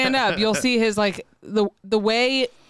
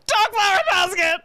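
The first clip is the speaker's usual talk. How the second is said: high teary wail, loud and strained; crying out in pain; 12 semitones higher, 4 dB louder than usual. Retold or imitated. To the doctor, a wailing teary cry. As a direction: cry out the words through pain. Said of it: "She was, like, crying".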